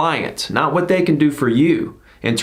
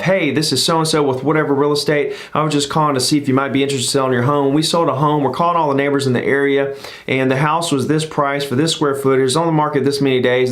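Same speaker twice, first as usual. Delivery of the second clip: mock agent blabbing fast; rambling fast pitch; faster than usual. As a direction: rush through it like a nervous agent. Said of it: "They just start blabbing".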